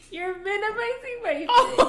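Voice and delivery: high, loud mock wail; hammed-up tearful protest, half laughing; mock crying. Retelling her breakdown to a friend, high mock-crying protest. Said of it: "And then I started crying".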